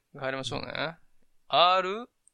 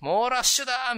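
hammed-up fight commentary at high pitch; boxing commentator; 9 semitones higher than usual. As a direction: call a furious flurry like a fight commentator. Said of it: "ボクサーとかの".

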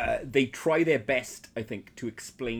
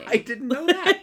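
surprised laughing; 8 dB louder than usual. Real, not acted. Mock shock at Affleck fandom.

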